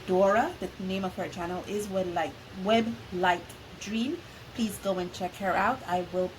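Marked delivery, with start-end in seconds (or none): none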